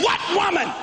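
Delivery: high, shouted confusion; genuinely lost; baffled shout. Retold or imitated. Husband demands which woman, shouting high. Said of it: "I said".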